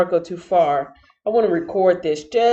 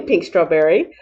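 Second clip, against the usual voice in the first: ordinary delivery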